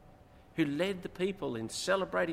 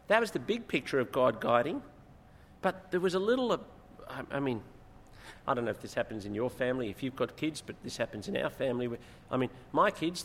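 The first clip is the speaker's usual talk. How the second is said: usual speaking voice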